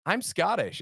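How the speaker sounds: high, loud proud claim; mock American. American tourist claiming heritage, high mocking voice. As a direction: lampoon an American boasting Scottish heritage. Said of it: "and they go".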